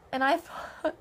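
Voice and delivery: usual speaking voice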